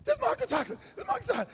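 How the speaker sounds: excited introduction. Retold or imitated. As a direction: proudly show him off. Woman excitedly introduces friend.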